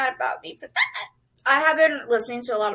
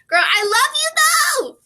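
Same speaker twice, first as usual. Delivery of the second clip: higher and louder, giddy; gushing; 6 dB louder, faster than usual. Real, not acted. Vlogger gushing over pumpkin spice, higher and louder. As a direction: gush over it like a best friend. Gushing about Starbucks drink.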